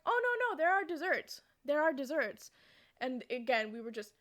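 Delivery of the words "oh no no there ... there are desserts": high, loud, hasty correction; emphatic insistence. Retold or imitated. Host corrects the baffled family, loudly insistent. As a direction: insist as if clearing up a big misunderstanding.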